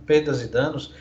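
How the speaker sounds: ordinary talk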